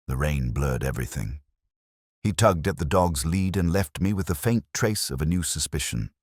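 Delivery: plain reading voice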